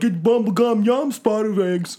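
high, slow, loud ad jingle voice; sing-song fake commercial; mock commercial voice.

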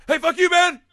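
high, loud mock tough-guy yell; yelling threat. Dad yelling at David to stay away, hammed-up. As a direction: shout it like a rich dad trying to be tough.

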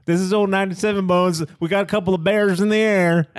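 loud, high CB trucker call; trucker CB radio. Pretend. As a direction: bark it over the CB radio.

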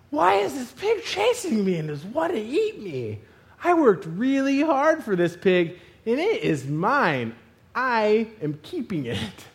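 slow, emphatic mock outrage; drawn-out possessive complaint; indignant protest. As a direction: start high and whiny, then dig in stubbornly. Acts out indignant thoughts while chased.

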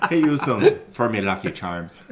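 hammed-up bad Irish accent, laughing through it; mock Irish brogue, half laughing. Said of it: "Terrible, awful Irish accents".

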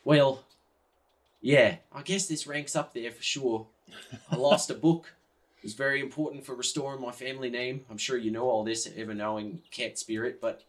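melodramatic lament to a spirit; despondent Yuri. Acted. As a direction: confess your ruin, sinking as you go.

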